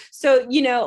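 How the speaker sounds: normal talking voice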